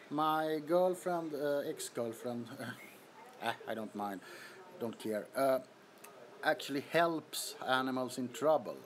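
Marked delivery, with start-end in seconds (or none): none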